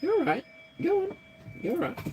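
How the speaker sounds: high soothing coo; baby talk to pet. Acted. Soothing her nervous pet, high. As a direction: reassure the little pet.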